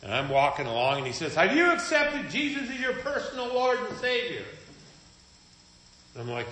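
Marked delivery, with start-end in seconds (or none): 1.3-4.4 high, eager preacher voice, much higher than his own; mock evangelist pitch to a passerby; pushy street evangelist. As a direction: mimic a zealous stranger pushing salvation. Evangelist accosting him, retold.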